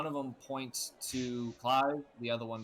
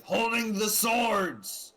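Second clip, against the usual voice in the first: very slow, high, loud shout; guards shouting; 9 semitones higher, 8 dB louder, much slower than usual. Acted. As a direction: bellow it together as guards. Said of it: "they both respond with".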